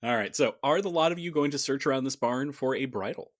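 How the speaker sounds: ordinary talk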